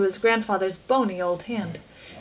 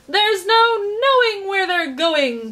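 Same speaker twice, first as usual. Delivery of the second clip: much higher, slow, whooping cry; hooting with laughter; 9 semitones higher, 4 dB louder, slower than usual. A narrator's reading. Chocolate maker in the dark tunnel, hooting gleefully. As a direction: crow it out with glee. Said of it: "hooting with laughter".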